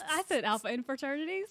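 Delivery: usual speaking voice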